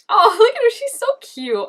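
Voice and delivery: ordinary delivery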